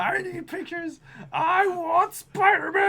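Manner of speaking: high, drawn-out mock yell; ranting newspaper boss. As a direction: stretch out the demand in a hammed-up wail. Keeps up the ranting boss impression.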